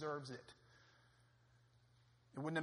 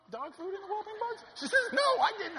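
laughing; 11 dB louder than usual. Real, not acted. Laughing at the wife's answer.

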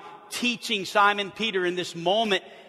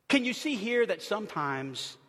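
ordinary talk

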